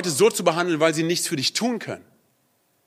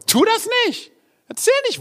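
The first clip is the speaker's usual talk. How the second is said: high squeaky scolding voice, much higher; mock scolding aside. Preacher scolds a rude churchgoer in high mock voice.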